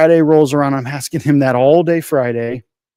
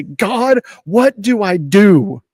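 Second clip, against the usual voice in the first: desperate cry to God; 5 semitones higher, much slower than usual. Retold or imitated. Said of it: "I just grab my hair and I say".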